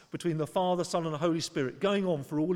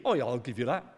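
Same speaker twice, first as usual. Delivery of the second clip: glib mock reply; 5 semitones lower, faster than usual. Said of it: "That is not a glib".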